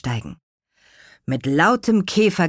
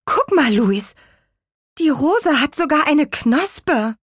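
put-on high, raised child character voice; amazed discovery; 10 semitones higher, 4 dB louder than usual. A narrator's reading. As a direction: point out the marvel, drawing out the end. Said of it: "machte diese unglaubliche Entdeckung".

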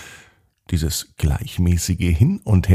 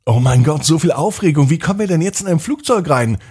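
excited; 8 semitones higher, faster than usual. Acted. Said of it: "denkt sich Ella".

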